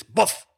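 loud high boof; proud dog barking about its pups; gruff bark. Acted. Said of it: "strange, gruff voice".

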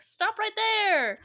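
high, stretched-out order; mock yelled command. Attacker ordering her to halt, high slow mock yell.

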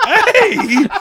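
high, loud comic yelp; hammed-up shout of protest; mock indignant shout. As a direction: cry out in mock outrage. Dad's indignant reaction, acted out.

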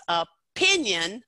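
"opinion" split, a weak o, then a strong PIN-ion; stress on second syllable. Shown as a demonstration. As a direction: break after the O and punch PIN in "opinion". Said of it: "put the most stress on that second syllable".

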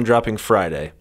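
ordinary delivery

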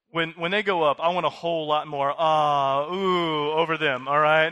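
loud hyped-up call for cheers; cheering prompt. Shown as a demonstration. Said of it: "I have much higher expectations".

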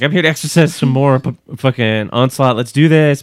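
loud hyped-up declaration; mock rallying cry. A co-host voicing David versus Onslaught, loud. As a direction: shout the boast to the team. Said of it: "He's like".

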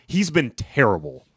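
ordinary delivery

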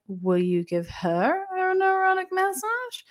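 slow, climbing to a high, drawn-out pitch; high mock question. Man asking masseuse about his companion, high mock question. Acts out Greg's cheeky question.

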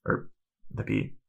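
normal talking voice

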